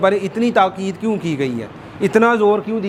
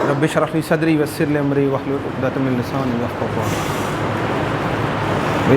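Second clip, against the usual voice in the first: slow, measured Quranic prayer chant; slow recitation; 4 semitones lower, slower than usual. Acted. Teacher opening class, reciting a slow prayer. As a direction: intone the dua slowly and reverently.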